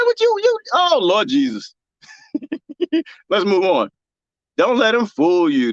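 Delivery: ordinary delivery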